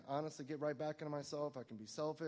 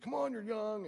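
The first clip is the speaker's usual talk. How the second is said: sponsor's cheer; 9 semitones higher, 6 dB louder than usual. Retold or imitated. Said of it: "he'd be like".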